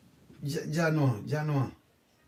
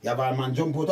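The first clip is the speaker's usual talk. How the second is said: ordinary talk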